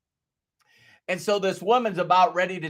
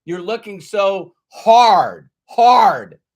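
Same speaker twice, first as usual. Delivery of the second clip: louder on each hard, slow; building emphasis; 3 semitones higher, 9 dB louder, slower than usual. Real, not acted. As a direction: push the word hard out harder the second time. Stressing how hard we look.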